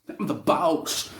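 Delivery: mock swagger, loud and drawn out; slow, loud imitation of a show-off; cocky boast. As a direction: crow it like a cocky champion. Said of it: "looking all cocky".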